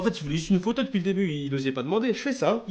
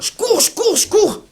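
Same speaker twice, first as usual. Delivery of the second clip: high, loud, breathless repeat; frantic running; 7 dB louder, slower than usual. Real, not acted. Narrator fleeing down the street, frantic. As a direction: press on like fleeing for your life.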